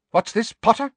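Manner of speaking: high, sharp teacher's demand. A narrator's reading. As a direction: round on the pupil sharply. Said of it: "said Professor McGonagall sharply".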